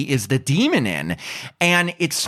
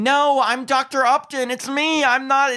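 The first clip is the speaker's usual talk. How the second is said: panicked yelling; 11 semitones higher than usual. Retold or imitated.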